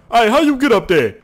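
high, loud put-on voice; incredulous game bystander. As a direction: play an astonished onlooker calling up. Voices another character asking him questions.

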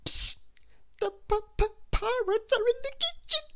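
very high, squeaky stammer; frightened stage whisper. A narrator's reading. Mister Jelly warns the policeman of pirates, frightened stage whisper. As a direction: stammer a high, urgent warning. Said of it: "whispered Mister Jelly".

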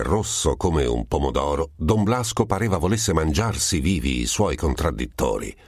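ordinary delivery

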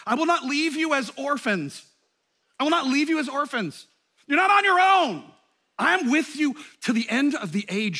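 loud, high, impassioned promise; fervent promise. Retold or imitated. Jesus promising his followers, loud high impassioned vow. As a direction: proclaim it with full force. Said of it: "Jesus says, these are some of the last words".

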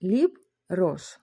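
"Ліб, рош" as two detached syllables. Shown as a demonstration. Teacher counting two syllables for learners, syllable by syllable. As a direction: pause between "Ліб" and "рош". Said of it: "В нас два склади".

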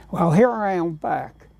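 normal talking voice

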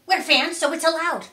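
loud, high fan voice; rowdy fans. A narrator's reading. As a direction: insist it like a cheeky crowd. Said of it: "they said".

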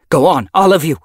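loud, high urgent command; urgent order. A narrator's reading. Yellowfang hurrying the kits away, loud order. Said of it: "she ordered them".

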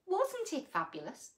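ordinary delivery, nothing marked

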